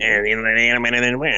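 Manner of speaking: garbled Popeye-style muttering; cartoon mumble. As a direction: mumble along like a cartoon sailor. Joins in the Popeye mumbling bit.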